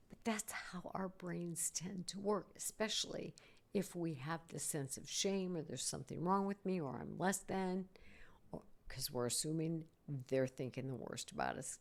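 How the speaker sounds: whisper. Real, not acted. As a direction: lean in and whisper about shame. Confiding about shame.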